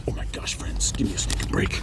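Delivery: a hushed, fed-up grumble to yourself; exasperated whisper. Acted. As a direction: whisper your frustration under your breath. Frustrated with the timer.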